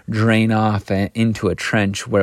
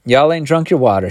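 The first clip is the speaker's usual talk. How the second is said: higher, curt prompting; short; 6 semitones higher, 4 dB louder than usual. A narrator's reading. Missus Turpin to the hired help, short. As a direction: say it shortly, impatient to be done. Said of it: "she said shortly".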